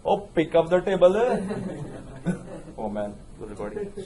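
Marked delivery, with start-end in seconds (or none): 0.0-1.1 put-on fast, playful Punjabi accent on pick up the table. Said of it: "But in Punjabi accent". 1.2-2.7 laughing. Real, not acted. Laughs after accent joke.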